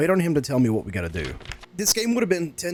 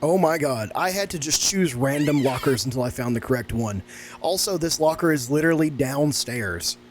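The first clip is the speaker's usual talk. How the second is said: ordinary delivery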